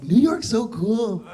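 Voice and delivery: loud, slow, high put-on LA voice; mocking LA locals. Comic mocking Los Angeles people gushing over New Yorkers, hammed-up loud voice. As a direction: gush like a starstruck local, hammed-up. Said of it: "they're like".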